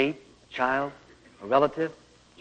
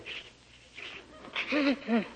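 shushing; 8 semitones higher, 9 dB quieter than usual. Retold or imitated. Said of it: "And I say".

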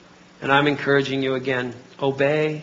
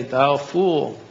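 archaic God voice. Retold or imitated. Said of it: "No, he would say".

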